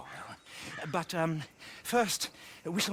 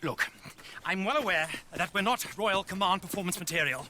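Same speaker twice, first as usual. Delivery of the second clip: ordinary talk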